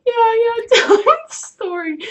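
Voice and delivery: high, loud blubbering imitation; mock crying. Sobbing girl asking her friend to tell the cop, mock crying. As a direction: blubber it out in an exaggerated high wail. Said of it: "when they're crying".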